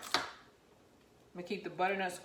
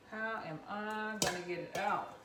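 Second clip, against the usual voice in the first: normal talking voice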